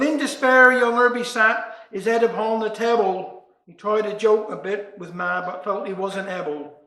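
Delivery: ordinary delivery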